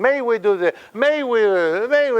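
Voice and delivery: mock someone who can't finish a wish; indecisive. Teacher mocking people's weak speech, indecisive. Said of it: "because they are indecisive".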